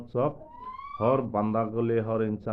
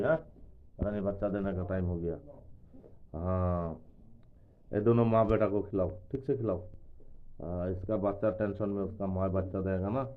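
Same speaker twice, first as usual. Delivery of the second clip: ordinary talk, nothing marked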